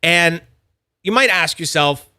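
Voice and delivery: ordinary talk, nothing marked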